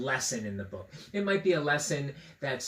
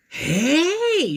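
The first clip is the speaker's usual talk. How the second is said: high, loud startled "Hey"; sudden realisation, shocked little girl; high startled girl cry; 7 dB louder than usual. A narrator's reading. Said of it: "This is her shocked and surprised".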